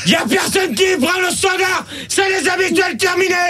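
high-pitched furious outburst; real red-faced yelling; frightening angry shout. Real, not acted. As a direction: shout everyone down and shut it off. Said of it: "arrête, tu fais peur à tout le monde".

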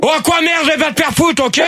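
high-pitched real anger; angry outburst. Real, not acted. Host swearing back at a caller at the show's start, angry outburst. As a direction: lash out at the caller. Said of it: "[name], doucement quand même".

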